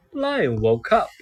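plain reading voice

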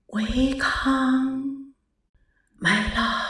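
faint voice from all around; soft distant voice. Acted. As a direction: call softly from far away. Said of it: "轻轻的声音".

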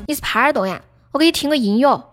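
ordinary delivery

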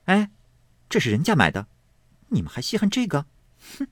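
a mild sneer with a scoffing hmph; lightly voiced maid, mocking; scornful maid. A narrator's reading. 产姐儿 snaps at Fangguan. (略带不屑的冷哼)

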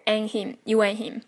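and him as "an him", the d unreleased; dropped plosive. Shown as a demonstration. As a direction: swallow the d in and. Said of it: "爆破".

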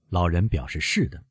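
plain reading voice

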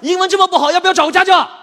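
loud, high taunt; mocking jab. [name] taunting his friend to hire a tutor, loud and high. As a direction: rub it in, gleeful.

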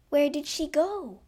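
high, loud bewildered question; alarmed question. A narrator's reading. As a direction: call it out in confusion. Audience alarmed at her vanishing.

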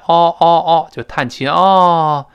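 loud sighing "哦" like a breath out. Shown as a demonstration. Teacher demonstrates the sighing American o vowel. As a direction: sigh out the American o sound.

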